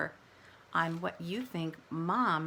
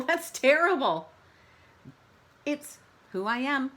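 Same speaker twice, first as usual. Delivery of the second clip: ordinary delivery, nothing marked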